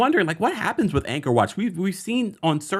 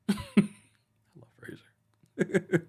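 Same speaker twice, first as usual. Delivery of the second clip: slow breathy whisper; 7 semitones higher, 5 dB quieter, much slower than usual. Real, not acted. Host confiding her love for Frasier in a slow whisper. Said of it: "whispered fan aside".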